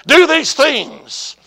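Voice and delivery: loud, high, slow command; commanding voice of God. Retold or imitated. God answers the preacher's question, loud and commanding. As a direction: boom each word out slowly.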